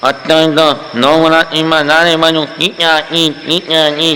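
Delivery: imitating a nasal speaker, slow and slurred; mock nasal garbled speech; nasal mumble. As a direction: mumble through the nose with a laugh. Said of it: "એ લોકો નાકમાંથી બોલે".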